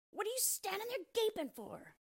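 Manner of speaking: very high, angry dwarf screech; enraged little dwarf's shriek; screaming dwarf. A narrator's reading. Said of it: "screamed the dwarf".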